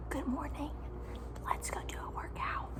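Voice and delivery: soft early morning whisper. Real, not acted. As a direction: whisper so nobody wakes. Early morning, dark outside.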